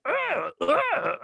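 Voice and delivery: high mock grunting noises; caveman grunts. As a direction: grunt like a group avoiding words. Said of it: "a noise and a gesture".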